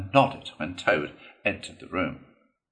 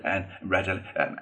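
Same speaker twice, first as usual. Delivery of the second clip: ordinary delivery, nothing marked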